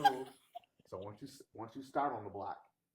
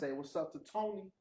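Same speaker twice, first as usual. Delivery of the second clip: put-on New York accent; 8 semitones higher, 3 dB louder than usual. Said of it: "A little Philly in there".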